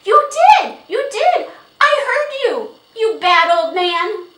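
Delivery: put-on very high, loud childish outburst; crying out. A narrator's reading. Little girl accusing the old horse owner, crying out. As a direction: shout the accusation at the top of a high voice. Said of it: "Nan cried out".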